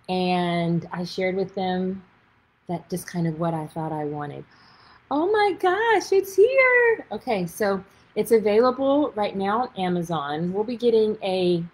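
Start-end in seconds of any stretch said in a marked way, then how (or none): 5.1-7.1 high gasping delight; breathless at seeing it finally here; thrilled. Real, not acted. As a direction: gasp with joy. Gasping at finished book.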